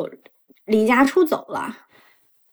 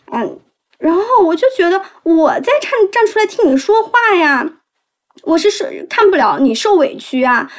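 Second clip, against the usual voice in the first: usual speaking voice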